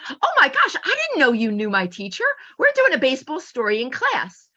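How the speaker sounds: put-on high, squealing kid excitement; excited kids. Kids gushing to their teacher, high and excited. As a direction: gush like an amazed child.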